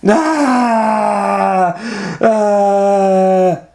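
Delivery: high, loud drawn-out scream; two long acted wails of alarm; long panicked scream. Elephant learning of the bird, screaming loudly.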